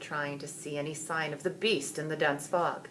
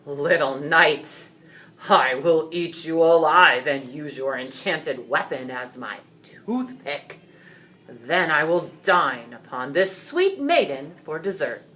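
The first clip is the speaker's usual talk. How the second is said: dragon threat; 8 dB louder than usual. A narrator's reading. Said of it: "dragon threatens George".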